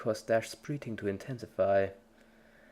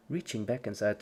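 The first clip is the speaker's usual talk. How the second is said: plain reading voice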